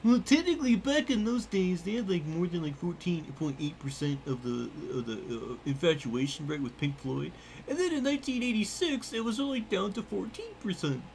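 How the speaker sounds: high, nitpicking know-it-all; nerdy voice. Pretend. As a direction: lecture like a smug nerd. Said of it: "the nerdy voice".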